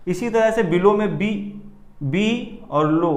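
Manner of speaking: slow teacher demo of बी and लो; stress on बी. Shown as a demonstration. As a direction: stretch बी, let लो fall away. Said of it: "बी जब बोला तो थोड़ी आवाज़ भी हमारी तेज निकली".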